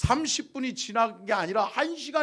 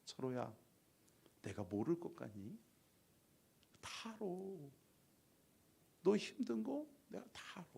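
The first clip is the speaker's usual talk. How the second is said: much softer, hushed and tender; God's whisper; 10 dB quieter than usual. Retold or imitated. Pastor voicing God's hushed comfort to Cheolho, soft whisper. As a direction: soothe him quietly, as God comforting. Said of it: "속삭여 주시는 거예요".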